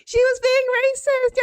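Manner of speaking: high, whiny mimicry; squeaky mock outrage; mocking complainers. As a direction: imitate critics in a silly high voice.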